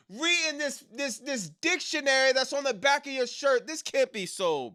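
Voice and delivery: high, loud disbelieving yell; incredulous rant. Real, not acted. Streamer yelling at Chad Gable over his merch, high and loud. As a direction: shout in real disbelief at the shirt.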